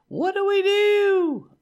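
high, slow falsetto mockery; mocking clueless voice. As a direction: squeak it out like a lost player.

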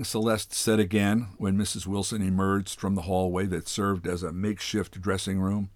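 ordinary delivery

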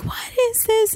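high, scared question; frightened kid in the bathroom; panicked question. Retold or imitated. Panicking quote of her younger self.

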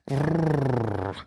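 drawn-out mock growl; imitated Wolverine growl. Co-host mimicking how Wolverine starts talking, imitated growl. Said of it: "every time he starts talking, he starts with".

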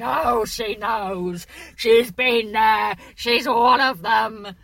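put-on loud, slow shouting old woman; shouting old lady. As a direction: bellow it out like a confused old lady. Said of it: "starts shouting at you".

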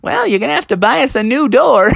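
high weasel voice with a cackle; weasel janitor. Acted. Weasel janitor explains the keys to Froggy, high and cackling. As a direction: play a dithering weasel, ending in laughter. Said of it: "the weasel was like".

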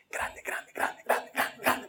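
put-on breathless child's whisper; urgent whisper. Daughter whispering her fries order to dad. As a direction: whisper it fast, like a kid sneaking an order.

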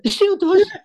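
very high, loud Scouse kid impression. Storyteller repeating the kid's punchline, hammed-up high Scouse accent. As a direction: squeal the punchline in mock Scouse. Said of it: "Scouse accent".

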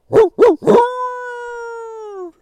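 high barks into long howl; wolf howl. Acted. Willie the Wolf answers with a howl. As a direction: bark twice, then howl loud and long. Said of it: "Willie the Wolf goes".

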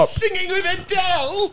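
high, bright-eyed Corden voice; James Corden impression. Comic doing Corden for friends, high excited host voice. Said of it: "There's two of us".

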